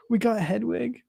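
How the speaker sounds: high, delighted mimicry; imitating excited kids. Parent echoing kids finding Hedwig in the set, high excited imitation. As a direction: echo a thrilled kid in a high voice.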